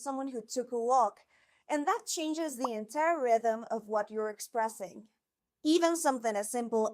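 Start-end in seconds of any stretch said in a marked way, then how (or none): none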